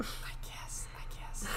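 unvoiced whisper, barely there; whispered concession. Real, not acted. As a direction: concede it under your breath.

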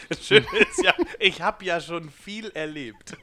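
laughing through the words; can barely speak for laughing. Real, not acted.

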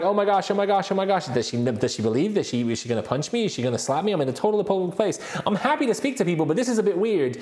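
fretting fast about being slapped; panicked inner monologue. Retold or imitated. Speaker on the tube, dreading approaching a stranger, panicky.

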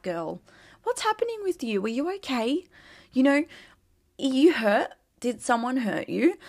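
high, sing-song mock sympathy for a hater; mock concern. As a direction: coo at the hater as if pitying her.

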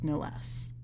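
usual speaking voice

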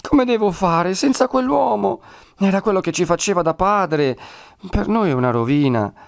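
high, tearful-sounding plea; almost crying. A narrator's reading. Woman left without the man who helped them, almost crying. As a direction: wail it high, easing down towards the end. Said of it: "quasi piangendo".

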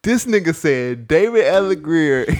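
slow, laughing disbelief. Real, not acted. Laughing at friend's mistake.

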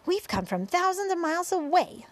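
high, projected centipede reply; shouting back. A narrator's reading. Centipede answers the police chief below, shouting back. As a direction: call the answer down from a height. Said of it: "the centipede shouted back".

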